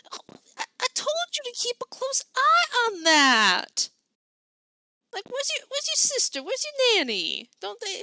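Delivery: high auntie voice, gently chiding; worried scolding from a fond aunt. Acted.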